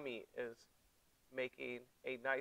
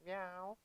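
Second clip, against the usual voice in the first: soft, higher meow with a rise; a quick kitten meow; cat meow; 4 semitones higher, 8 dB quieter than usual. Shown as a demonstration. Teacher leading the kids in cat pose, soft meow. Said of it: "Exhaling cat".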